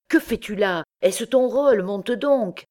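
high, scolding questions and order; irritated shout. A narrator's reading. Poet berating the actor who missed his entrance, irritated. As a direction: scold him impatiently, pitched well up. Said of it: "cria le poète irrité".

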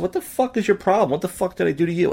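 high-pitched, incredulous confrontation; indignant retold outburst. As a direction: re-enact challenging someone, pitch jumping way up. Retelling his confrontation with angry comic.

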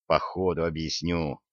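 low, soft near-whisper; hushed aside. A narrator's reading. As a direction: drop it low and quiet. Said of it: "прошептал я".

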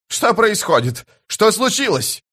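high-pitched, alarmed questions; panicked shout. A narrator's reading. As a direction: demand answers in rising panic. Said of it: "закричал Пормкваль". (панический крик)

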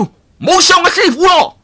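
very high, loud shout in a different voice; crying out. Acted. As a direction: yell to stop the blow. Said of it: "一個叫". (岳老三大叫阻止，聲調極高)

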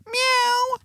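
high imitated miaow; cat meow. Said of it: "meow means".

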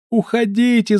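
high, loud wailing woman. A narrator's reading. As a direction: wail it out in panic. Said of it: "заголосила женщина".

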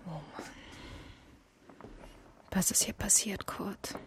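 hushed whisper in an eerie flat; breathless whisper at a crime scene. Real, not acted. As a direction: whisper it, as if someone might hear. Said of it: "Du brauchst nicht zu flüstern".

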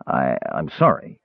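low, soft stammered apology; stammering. Acted. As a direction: stumble over the words, embarrassed. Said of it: "Caramon stammered".

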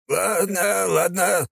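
put-on high, hurried frightened old man; babbling. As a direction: babble it out in fear. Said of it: "залепетал граф".

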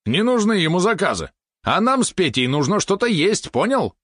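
high, angry retort; snarling. A narrator's reading. Valeron scolds the spider about earning food, high angry voice. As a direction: lay into the robot, pitch way up. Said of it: "ощерился на него Валерон".

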